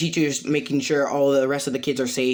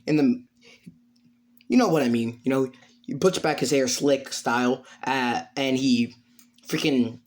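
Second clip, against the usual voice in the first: ordinary delivery, nothing marked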